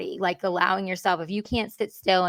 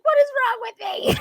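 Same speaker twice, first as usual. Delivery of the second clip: anguished, much higher cry of despair; reliving a breakdown in front of the mirror, crying out in despair; desperate cry; 3 dB louder than usual. Retold or imitated. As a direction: wail it like you're falling apart. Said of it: "It was like".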